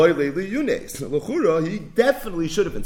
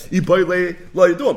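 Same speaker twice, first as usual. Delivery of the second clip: arguing seller; 3 semitones higher, 7 dB louder than usual. Retold or imitated. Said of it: "He said".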